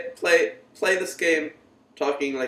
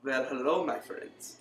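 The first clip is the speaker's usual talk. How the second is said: attempted Russian accent; 2 semitones lower, 9 dB quieter than usual. Friend taking a turn at a Russian accent, softly. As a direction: try a quiet Russian greeting. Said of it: "You try speaking in a Russian accent".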